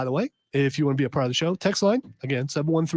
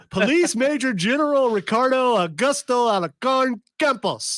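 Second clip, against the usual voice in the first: mock Colombian accent on the name, higher; Spanish accent; 6 semitones higher, 3 dB louder than usual. Shown as a demonstration. Radio host retrying the general's name in a Colombian accent. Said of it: "Hey, that, that was excellent".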